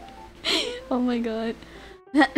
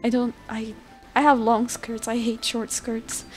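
normal talking voice

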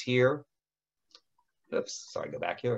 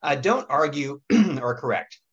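hoarse, throat-clearing raspy voice; 6 semitones higher, 6 dB louder than usual. Real, not acted. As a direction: speak through a raspy throat. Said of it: "pardon my raspy voice here".

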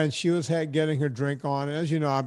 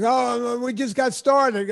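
high, slow, drawn-out whine; mock the tipsy friends begging to stay; hammed-up pleading; whiny protest; 8 semitones higher, 4 dB louder, slower than usual. Drunk friends protest leaving.

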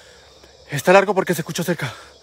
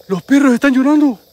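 raised, high-pitched alarm; 6 semitones higher, 4 dB louder than usual. Real, not acted. Ghost hunter telling viewers the dogs are crying, alarmed. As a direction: call it out higher and louder.

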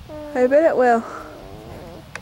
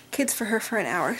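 whispered aside; 5 semitones lower, 9 dB quieter than usual. Real, not acted. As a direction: drop to a hushed whisper. Secret babysitting aside.

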